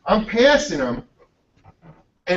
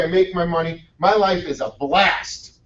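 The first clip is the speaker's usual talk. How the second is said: ordinary talk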